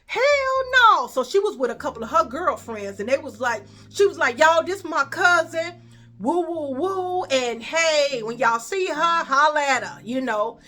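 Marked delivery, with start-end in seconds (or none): 0.0-1.1 high, loud, slow hyped reaction; hyped cousin. Retold or imitated. Cousin hearing she goes there now, loud high delight. 4.4-10.0 loud, higher proud introduction; proud cousin. Retold or imitated. Cousin introducing her to girlfriends, proud and loud.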